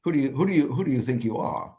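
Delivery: hammed-up upper-class English scolding; a touch of a posh British accent; mock posh British. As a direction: play a haughty English royal, lightly. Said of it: "that your best King Charles accent?".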